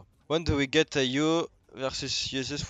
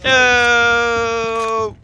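shouted callout; 11 semitones higher, 9 dB louder than usual. Real, not acted. Calls out mid-match.

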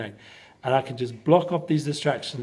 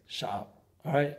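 hushed mock shut up; 9 dB quieter than usual. Telling the phone to be quiet.

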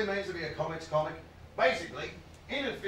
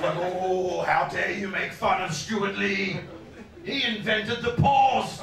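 indignant fan, slow and building louder; mock outrage; 5 dB louder, slower than usual. Fan scolds comic for mocking Stuart Lee, mock indignant. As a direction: take great offence, stretching the words. Acts Stewart Lee's outraged fans.

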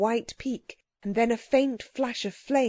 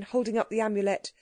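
usual speaking voice

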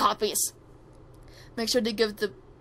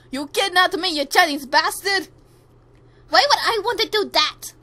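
loud, raised-pitch indignation; angry quarrel; 6 semitones higher, 7 dB louder than usual. Acted. Kidnapped captive and accused captor quarrelling, loud indignant voices. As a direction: argue loudly, pitched up in outrage.

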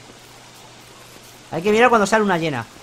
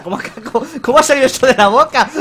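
louder laughing disbelief; 6 semitones higher, 5 dB louder than usual. Real, not acted. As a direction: laugh through the words in shock. Streamer laughs at funny line.

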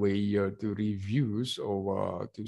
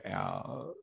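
usual speaking voice

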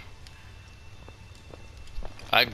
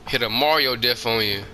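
ordinary delivery

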